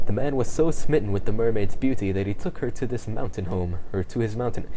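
ordinary talk

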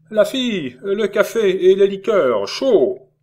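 loud, high call across the inn; shouted order for coffee and liqueurs. A narrator's reading. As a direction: summon the waitress loudly. Calling the serving girl.

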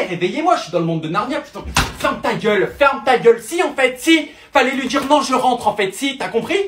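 loud, high screaming fury; screaming rage. Acted. Girlfriend kicking drunk boyfriend out of the house, screaming. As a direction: throw him out at the top of your lungs.

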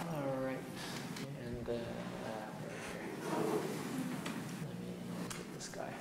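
very soft, low, halting aside; muttering to self. Real, not acted. Lecturer to self while moving to conclusions, muttering. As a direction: think aloud under your breath while busy.

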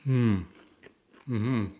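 humming with closed full mouth; muffled mm while chewing. Real, not acted. Seen 1: Cheeks puffed, chewing sandwich bite while humming approval.

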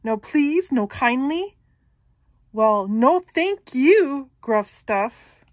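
mock the goat; sneering troll. Troll mocks goat's manners.

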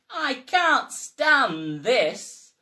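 slow, loud, drawn-out grumble; weary complaint. Acted. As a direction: drag every word out, fed up. Mister Bear complains, fed up.

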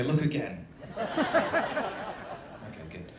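ordinary delivery

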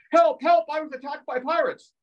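high-pitched pretend victim's wail; mock cry for help. Pirate victim calling out for rescue, mock panic. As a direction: squeal it like a panicked victim, hammed up.

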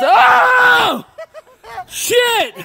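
very high, loud yelp of real fright; high-pitched shocked shout; startled scream. Real, not acted. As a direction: scream and curse as if someone jumped out at you. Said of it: "scared the shit out of me".

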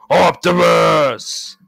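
long, loud cry of a name; villain's shout. Acted. Villain calls out Optimus, drawn-out shout.

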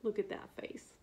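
soft whispered aside; fond whisper. Real, not acted. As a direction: whisper while pointing at the picture. Showing the picture.

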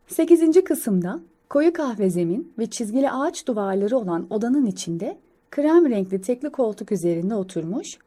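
plain reading voice

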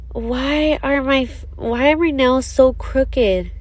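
normal talking voice

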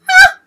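very high, loud shriek of joy; real delighted scream; excited scream. Real, not acted. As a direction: let out a fangirl scream. Fan screams at reveal.

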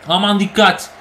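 ordinary delivery

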